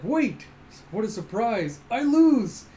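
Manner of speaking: hammed-up cheerful defeat; cheerful surprise. Tunpo cheerfully conceding to Alita, hammed-up cheer. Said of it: "And he's like".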